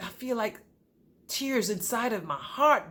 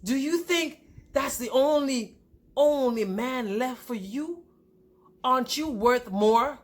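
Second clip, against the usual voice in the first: slow, higher-pitched pulpit challenge; preaching pastor; 4 semitones higher than usual. Retold or imitated. Pastor asking her about her worth, preaching emphatically. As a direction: stretch each question out like a preacher. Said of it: "the pastor was preaching a message".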